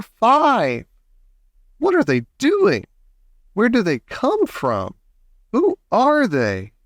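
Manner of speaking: high, astonished exclamations; excited crowd shouting. A narrator's reading. Onlookers counting little men, high excited cries. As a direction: call out each one high, not at full volume. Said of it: "everybody started shouting at once".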